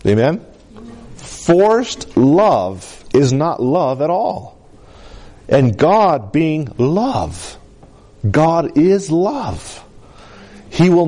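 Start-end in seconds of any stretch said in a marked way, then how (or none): 1.2-4.6 drawn out very slowly, each word weighed; slow right down and let every word land; very slow emphasis. Shown as a demonstration. Pastor repeating the key line to the congregation, very slow and weighty. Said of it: "Let me say it like this".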